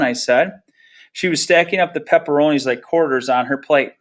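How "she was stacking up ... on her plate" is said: ordinary delivery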